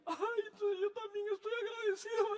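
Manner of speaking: put-on a small boy joining his father's tears; little son's voice. Pastor voices his small son answering tearfully. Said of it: "Le dije".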